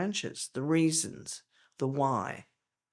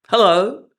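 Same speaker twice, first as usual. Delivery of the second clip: hello called out much louder, higher; a big shouted hello; 5 semitones higher, 16 dB louder than usual. Shown as a demonstration. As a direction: call hello across a room. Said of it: "I could say hello to you three times".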